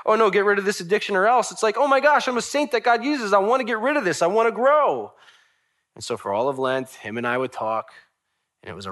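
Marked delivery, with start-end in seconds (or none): 0.1-1.5 high, loud, hurried alarm; mock panic. Friend imagined fearing his addiction, mock panic. Acted panicked threat, contrasted. 1.8-5.1 high, loud burst of eagerness; excited realisation. Acted. Friend realising he's a saint, excited and eager.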